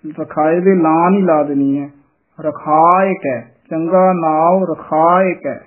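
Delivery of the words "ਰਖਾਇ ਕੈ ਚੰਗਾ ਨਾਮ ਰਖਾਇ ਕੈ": slow, with ਰਖਾਇ ਕੈ linked and unrushed; ਰਖਾਇ ਕੈ drawn out clearly; slow model reading. Shown as a demonstration. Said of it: "ਸੰਬੰਧਕ ਪਾਠ ਕਰਨਾ ਹੈ". (ਹੌਲੀ, ਰਖਾਇ ਕੈ ਜੋੜ ਕੇ)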